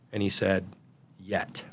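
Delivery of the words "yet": a soft single word, much softer; quiet answer. Retold or imitated. Son answering his dad's question quietly. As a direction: barely say Yet, almost under breath.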